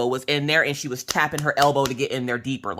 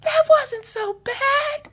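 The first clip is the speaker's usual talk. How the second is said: high, squeaky, slow fake cheer; hammed-up reassurance through tears; tearful brave face; much slower than usual. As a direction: squeak it out, pretending it was fine. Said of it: "I have a tear coming down my face".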